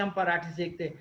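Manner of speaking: ordinary talk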